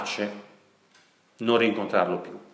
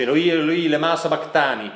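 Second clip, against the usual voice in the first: crying out; 5 semitones higher, 4 dB louder than usual. Retold or imitated. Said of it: "Gesù gridò a gran voce".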